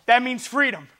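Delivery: ordinary talk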